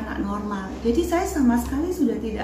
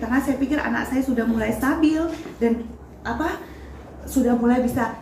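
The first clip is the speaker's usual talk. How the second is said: usual speaking voice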